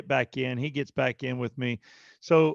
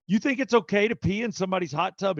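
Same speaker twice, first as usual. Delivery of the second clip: higher, indignant mock scolding; angry father-in-law; 7 semitones higher than usual. Father-in-law scolding son-in-law in hot tub, imitated anger. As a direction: retell his angry challenge, pitch raised. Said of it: "he's, he's angry".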